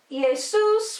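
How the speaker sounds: Jesus said ee-AY-soos; "Jesus" starting on an I sound; old Latin pronunciation. Shown as a demonstration. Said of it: "was replaced by the J".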